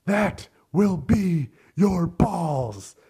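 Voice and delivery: quoting the father's over-the-top revenge threat from the film, loud and theatrical; dramatic movie threat. Retold or imitated. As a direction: growl the revenge line like a grieving dad gone mad. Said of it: "this super, like, dramatic line".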